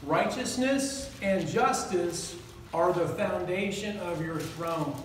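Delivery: slow, deliberate reading; each word weighed and unhurried; reading slowly. Shown as a demonstration. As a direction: read it slowly so it sinks in. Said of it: "I'm gonna read it slow for you".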